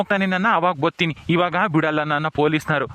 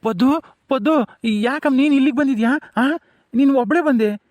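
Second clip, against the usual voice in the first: higher, startled calling her name; surprised calling; 6 semitones higher than usual. Acted. Father in custody startled to see his daughter. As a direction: call out to her, taken aback.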